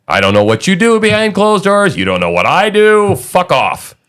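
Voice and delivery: high-pitched mock defiance, falling toward the end; acted indignant retort. Host voices someone guarding their privacy, indignant. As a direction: act out telling someone to butt out. Voices a live-and-let-live attitude.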